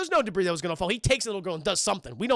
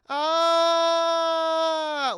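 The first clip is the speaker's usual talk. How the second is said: mock flat falling yell; 7 semitones higher, 4 dB louder than usual. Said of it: "like that, and it does not look good".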